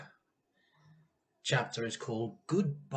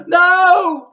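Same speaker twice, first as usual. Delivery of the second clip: very high, very loud scream; a desperate shriek; crying out; 20 dB louder than usual. A narrator's reading. George and Missus Cranky scream at Grandma to stop. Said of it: "the other two cried".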